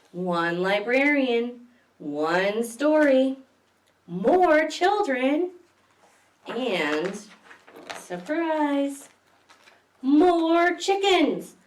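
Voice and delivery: put-on reading to children, slow building refrain; slow storybook refrain. A narrator's reading. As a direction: savour each phrase then burst on chickens.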